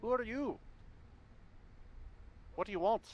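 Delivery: high, pointed Irish woman; Irish accent. Acted. Mother challenging strangers at her door, high Irish accent. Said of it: "she's Irish instead".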